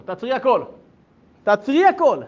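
loud, high command, building; acted urgency. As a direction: halt everything, insist harder each time. Acted urgent plea to stop everything.

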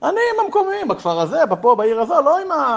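high-pitched, insistent self-defence; exasperated protest. Retold or imitated. Officer setting himself apart from the rabbi, exasperated protest. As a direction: push each phrase up in protest.

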